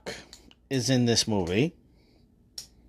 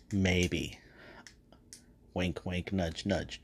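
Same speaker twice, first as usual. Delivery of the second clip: sly hint; 6 semitones lower, 11 dB quieter, slower than usual. Real, not acted. Hinting he may return.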